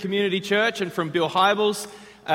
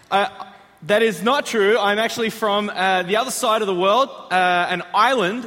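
ordinary delivery

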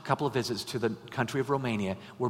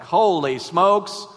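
loud, high desperate cry for help; much louder, higher mock desperation; 6 semitones higher, 9 dB louder than usual. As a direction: call out at the end of your rope. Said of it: "It's like".

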